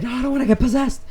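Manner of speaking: high, loud, fast panicked cry; acted panic. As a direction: play a terrified kid in a frenzy. Reenacts terrified childhood panic.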